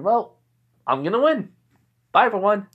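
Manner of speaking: high, breezy sign-off; cheerful. Acted. Soul Reaper signs off to the crowd, cheerfully confident. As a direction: announce victory cheerfully, no arrogance. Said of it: "He said it so cheerfully without any arrogance in his voice".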